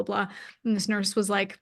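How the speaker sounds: ordinary delivery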